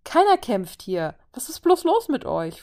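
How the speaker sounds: a desperate host cries out at his brawling guests; desperate outburst. Acted. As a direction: throw up your hands in despair. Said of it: "mit einem verzweifelten Unterton".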